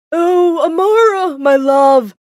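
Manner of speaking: loud, high, effusive lover's cry; gushing greeting. Acted. Fiancé greeting his runaway Amara, gushing loudly. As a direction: call out to a lost sweetheart, high and loud.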